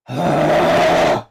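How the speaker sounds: loud, high exasperated sigh. Real, not acted. Fed up mid-rant, a loud exasperated sigh. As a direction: heave out a big exasperated sigh.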